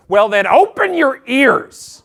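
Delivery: loud, high, slow and haughty; snippy retort. Acted. The flight attendant snapping back at him, slow haughty retort. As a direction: put him down, dragging it out. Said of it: "she looks at me and goes".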